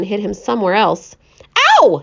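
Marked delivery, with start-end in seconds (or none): 1.8-2.0 sharp yelp of hurt; cry of pain. Acted. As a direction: yelp as the hail hits. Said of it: "he cried".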